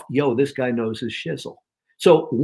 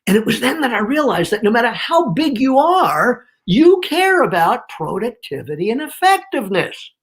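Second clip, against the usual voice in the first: higher, punched HOW BIG YOU ARE and PRODUCTIVITY; emphatic key point; 7 semitones higher than usual. Shown as a demonstration. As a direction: lift the pitch and lean hard on "productivity". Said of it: "I put it on".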